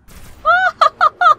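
loud, high stuttering oh-ho-ho; frantic high cackling cry; high panicked whoop. Real, not acted. Losing to strong enemy.

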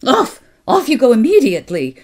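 high, loud, rapid dismissal; cold, proud. A narrator's reading. Aunt Beryl shoos the Kelvies out, calling imperiously. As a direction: shoo them off like chickens. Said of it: "she called, cold and proud".

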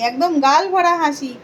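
sing-song doting on Giri sona; affectionate blessing. Acted. Blesses viewers through the doll. (আদুরে গলায় আশীর্বাদ)

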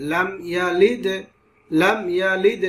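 "লাম ইয়া লিদ" with the m said short and clean; clear meem, no ghunna. Shown as a demonstration. Quran teacher showing izhar, clear without ghunna. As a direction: release the m before "ইয়া" without humming. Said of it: "গুণনা ছাড়া".

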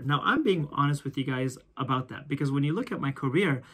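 usual speaking voice

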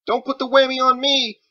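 high, loud frightened cry; panicked plea. Acted. Boy afraid of the wizard's spell, high panicked shout. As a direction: shout it, terrified of a curse.

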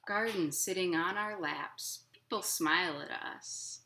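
gentle, lilting picture-book reading; reading to children. A narrator's reading. Librarian reading a picture book to children, lilting. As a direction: read it like storytime for little kids.

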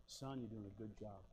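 low, hushed mock-confidential voice; retold whisper. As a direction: imitate a sergeant quietly encouraging a recruit. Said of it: "he whispered to me".

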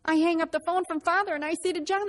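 ordinary delivery

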